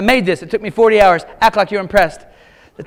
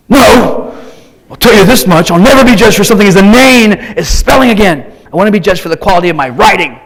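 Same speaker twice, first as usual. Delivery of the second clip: put-on loud, offended teen rant; indignant outburst; 8 dB louder than usual. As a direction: vow it with wounded pride. Son's angry outburst retold.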